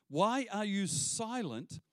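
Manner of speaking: slow, drawn-out question; Habakkuk asking God, slow and hurt; slow lament. Retold or imitated. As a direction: ask God slowly, pleading. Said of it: "Verse thirteen".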